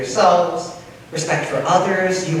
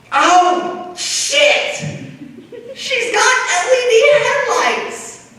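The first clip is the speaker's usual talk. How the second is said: shocked yell; much slower than usual. Real, not acted. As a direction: yell it in comic horror. Horror at the headlights.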